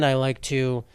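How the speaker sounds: ordinary talk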